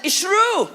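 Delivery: It's true said high and loud, hard stress on true; with an accent. Shown as a demonstration. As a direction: shout it back with weight on true. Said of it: "Everybody said it with an accent".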